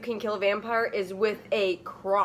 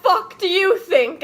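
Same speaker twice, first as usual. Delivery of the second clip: furious yell, high and loud; angry; 9 semitones higher, 6 dB louder than usual. Acted. Ebony yelling at a bandmate, furious. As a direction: snap back in rage. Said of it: "I asked angrily".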